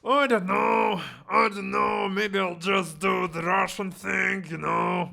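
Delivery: hammed-up loud comic whine; exaggerated quote. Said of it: "So I'm like".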